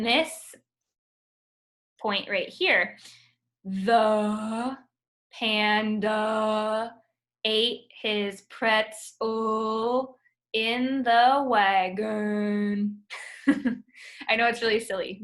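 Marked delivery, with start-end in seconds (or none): 3.6-13.0 very slow, drawn-out "The panda ate his pretzel in the wagon"; slow schwa reading. Shown as a demonstration. Teacher demonstrates the sentence for the class, very slow. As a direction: stretch every word lazily. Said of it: "you should try reading it like that".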